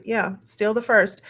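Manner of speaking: ordinary delivery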